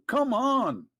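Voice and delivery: high, drawn-out mock exasperation; exasperated plea. Translating his bell message for the caller, mock exasperated. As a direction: stretch it out high, as if fed up.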